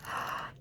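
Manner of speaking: soft, sharp breath in; astonished gasp. Real, not acted. Fan finding an item from the missed convention, astonished gasp. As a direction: gasp in real disbelief.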